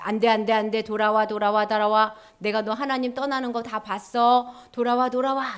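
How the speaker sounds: monotone robotic alarm voice; warning light. Acted. God's warning light calling back those who leave, monotone alarm. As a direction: chant it on one pitch like an alarm. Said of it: "경고등이 반짝반짝거리죠".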